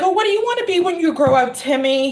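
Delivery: put-on high, quick question to a little kid; imitated grown-up quizzing a child; talking to a child. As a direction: chirp it high, as if asking a small boy. Nanny-style question to small boy.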